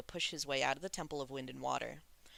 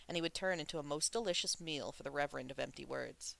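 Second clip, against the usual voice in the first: plain reading voice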